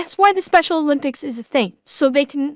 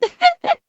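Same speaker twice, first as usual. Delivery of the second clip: high, rising laugh through tears; embarrassed laugh while crying; tearful laugh. Real, not acted.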